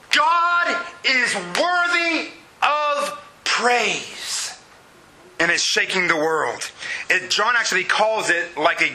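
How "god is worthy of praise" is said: very slow, high, majestic proclamation; heavens proclaiming. Retold or imitated. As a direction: stretch each word as if the heavens are speaking. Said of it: "the heavens open up and say".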